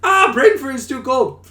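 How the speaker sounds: high, loud shout in drawn-out agony; hammed-up cries of pain; mock pained yelp. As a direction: yelp like you've been scalded. Acting out the porridge gag.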